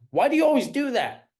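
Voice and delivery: high, loud, fast accusing tone; accusing question. Shown as a demonstration. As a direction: snap it like a scolding parent. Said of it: "there's a difference between, like".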